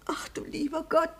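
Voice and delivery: soft, tearful fluster; flustered, nervous. Acted. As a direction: fret quietly, close to tears. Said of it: "eine etwas nervöse alte Dame".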